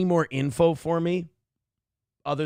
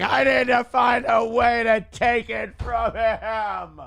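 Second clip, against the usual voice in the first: mock shouting, drawn out and higher; hammed-up bitter complainer, raised strained voice; angry, bitter people; 5 semitones higher, slower than usual. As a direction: imitate an angry mob, slow and strained. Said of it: "a whole army of really angry, bitter people going".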